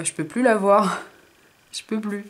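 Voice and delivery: ordinary talk, nothing marked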